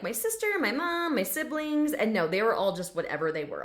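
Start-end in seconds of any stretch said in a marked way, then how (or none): 0.0-1.9 higher-pitched imitation of other pets; typical pet voice. Shown as a demonstration. Other animals naming their family, high and familiar. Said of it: "most times they're like".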